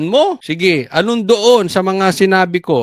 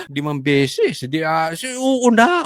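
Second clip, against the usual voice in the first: ordinary talk, nothing marked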